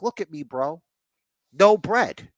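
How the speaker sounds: high-pitched, hammed-up wrestler comeback; Kaiser. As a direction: play a self-justifying wrestler pleading his case. Voicing Kaiser's swaggering answer.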